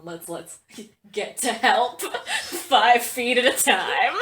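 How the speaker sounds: slow, giggling through words; laughing. Real, not acted. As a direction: laugh while saying it.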